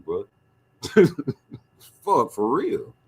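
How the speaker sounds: ordinary talk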